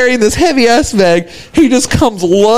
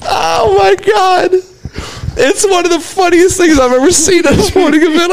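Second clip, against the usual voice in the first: high, squeaky voice full of laughter; breaking into laughter; 11 semitones higher than usual. Real, not acted. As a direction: gasp the words out between laughs. Cracking up at own story.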